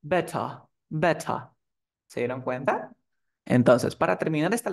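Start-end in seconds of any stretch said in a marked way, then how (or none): 0.0-1.4 "Better" terminado en schwa, sin R. Shown as a demonstration. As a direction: modela "Better" con final en vocal neutra. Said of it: "¿La R se pronuncia?". ("Better" británico, R final muda)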